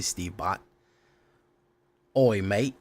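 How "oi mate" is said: light attempt at an Aussie accent. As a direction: try a mild Australian "oi, mate". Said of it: "didn't know you're an Aussie".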